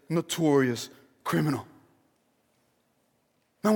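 normal talking voice

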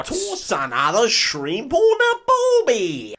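bad Australian accent, high, loud and drawn out; hammed-up, deliberately awful Australian accent; Quentin Tarantino. Podcaster mimicking Tarantino's cameo, badly faked Australian accent. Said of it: "give himself an Australian accent".

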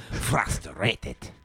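"Frustrated" as "frasta-rated", R rolled; playful over-pronounced "Frustrated"; exaggerated R. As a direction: ham up "Frustrated" in a silly voice. Joking put-on pronunciation.

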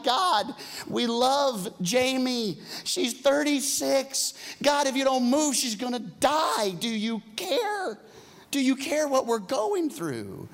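slow, high, pleading cry; desperate prayer. Retold or imitated. Church praying for sick Jamie, slow anguished plea. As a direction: beg God for a dying friend. Retold crying out to God.

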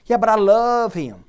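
mock a lovesick person protesting; pleading lovestruck objection.

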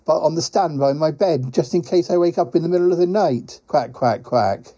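lightly voiced, quack-punctuated character; rueful quacking creature. Quacking visitor blames the rich pies, lightly rueful creature voice. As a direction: play a mildly complaining quacking creature.

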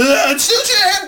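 high, loud outburst; over-the-top loud burst; sudden yell. Pretend. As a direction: blurt it out in a big silly shout. Bursts out yelling over co-host.

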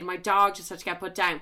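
ordinary talk, nothing marked

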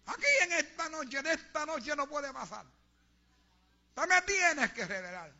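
high, slow, loud mock demand; shouting at the altar. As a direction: insist to God like a showy zealot. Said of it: "gritando en el altar". (exigencia fervorosa imitada, a gritos)

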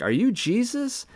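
high-pitched mock child voice, wide-eyed questions; imitated naive kid. Gullible movie kid voiced in retelling.

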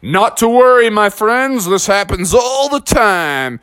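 high, put-on cheery officer voice; hearty officer, drawing out the end; breezy police officer. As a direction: reassure them with showy confidence. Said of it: "And the officer said".

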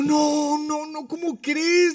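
very high wailing protest; hammed-up incredulous husband; mock dismay. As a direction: whine it way up high in exaggerated refusal. Retold protest at tiny restaurant.

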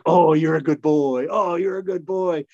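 put-on high, cooing good-boy voice; dog praise. Talking to a golden doodle licking his face, high and cooing. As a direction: coo at the dog.

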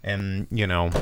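ordinary talk, nothing marked

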